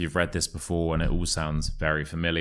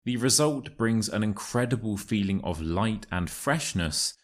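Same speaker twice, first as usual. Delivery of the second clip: model reading; 4 semitones higher than usual. Shown as a demonstration. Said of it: "listen to the passage and repeat after me".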